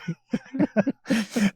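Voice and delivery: high giggling laugh. Real, not acted. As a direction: giggle helplessly. Laughing at end tag.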